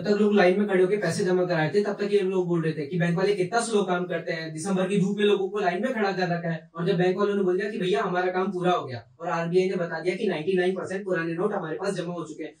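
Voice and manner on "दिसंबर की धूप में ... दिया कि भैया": ordinary talk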